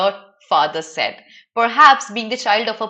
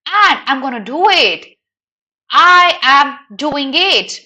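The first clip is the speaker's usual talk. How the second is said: higher, louder, pressing urgency; urgent, pressured; 4 semitones higher, 5 dB louder, slower than usual. Retold or imitated. Teacher acting out a character's urgent line. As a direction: push it out like he's under pressure. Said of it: "he says this with a lot of pressure in his head".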